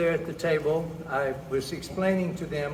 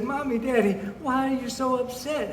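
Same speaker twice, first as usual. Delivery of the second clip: higher-pitched, pretend upset daughter; mock-tearful daughter; 6 semitones higher than usual. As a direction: plead with your parents in mild mock tears. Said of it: "She started crying".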